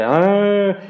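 high mock bawling wail; imitated crying. Fan recalling the lost final with his cousin, mock bawling. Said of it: "lloré como nunca, cabrón, así de".

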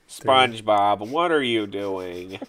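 slow, drawn-out cartoon voice; cartoon character impression. Fan quoting a SpongeBob scene, slow put-on cartoon voice. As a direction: stretch each word out, drooping at the end.

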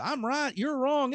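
ordinary talk, nothing marked

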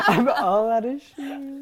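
high laughing mock farewell. Real, not acted. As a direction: laugh through it, slowly. Joking farewell line.

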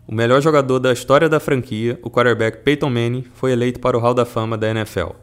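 loud, formal program opening; announcer intro. Acted. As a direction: present it like a radio announcer.

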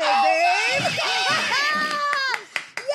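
loud laughing cheer; yelling and laughing at a surprise gift; excited shout. Real, not acted. Birthday surprise reveal.

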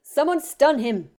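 slow, loud, high commanding snap; urgent angry command; snapped. Acted. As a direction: bark the order while pinning him down. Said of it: "Remus snapped".

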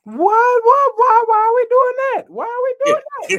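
very high shocked squeal. Real, not acted. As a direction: shriek it out in disbelief. Shocked by crude remark.

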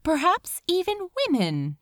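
ordinary delivery, nothing marked

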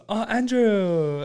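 high, drawn-out slow oh Andrew; imitating a fond mom. Andrew mimicking people fawning over his mom, slow high coo. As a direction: coo the name slowly like a doting mother. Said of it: "Andrew would be like".

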